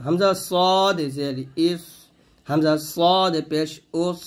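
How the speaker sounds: slow, with "সদ" thick, "ইস" and "উস" full-mouthed; emphatic Arabic sad in "সদ", "ইস", "উস", drawn out; heavy sad. Shown as a demonstration. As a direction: stretch a heavy "সদ", closing "ইস" and "উস" on a thick s. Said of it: "সদ মোটা করে".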